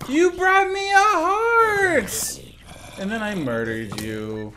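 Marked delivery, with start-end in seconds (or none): 0.1-2.4 high, slow, mock-weepy gratitude; drawn-out fake sob of thanks; mock tearful. Thanking a player for the heart, mock tearful.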